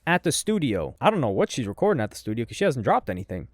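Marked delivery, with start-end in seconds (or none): none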